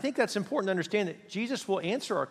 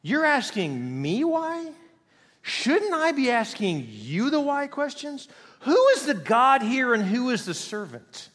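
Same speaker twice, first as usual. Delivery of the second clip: higher, incredulous challenge, slowing down on the questions; indignant God; 7 semitones higher, 3 dB louder than usual. Acted. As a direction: turn the question back on the asker. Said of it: "how does God respond".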